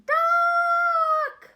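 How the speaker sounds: the duck on the cow's back yells, very high and loud; loud duck shout. A narrator's reading. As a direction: bellow it like a frantic duck. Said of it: "And he's saying".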